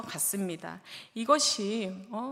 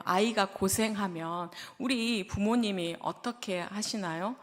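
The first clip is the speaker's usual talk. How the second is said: usual speaking voice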